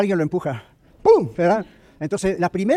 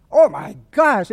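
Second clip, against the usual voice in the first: dawning surprise; 3 semitones higher, 6 dB louder, much slower than usual. Retold or imitated. Realizing the weight of events.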